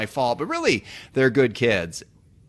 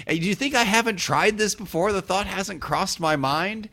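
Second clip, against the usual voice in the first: pitched higher, playfully indignant; mock exasperation; 8 semitones higher than usual. Real, not acted. To Steve, whose advice he has already tried: mock-exasperated. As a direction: act wounded that he'd think you hadn't tried.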